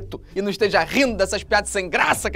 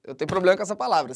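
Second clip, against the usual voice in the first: ordinary delivery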